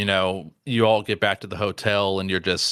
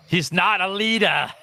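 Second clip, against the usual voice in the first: put-on not a Boston accent; 8 semitones higher than usual. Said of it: "that was not a Boston accent".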